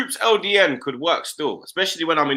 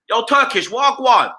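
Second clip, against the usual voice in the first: higher-pitched mock American greeting; little American accent; 7 semitones higher, slower than usual. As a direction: imitate New York friends' American accent. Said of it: "in that little American accent".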